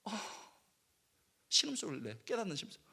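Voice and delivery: ordinary delivery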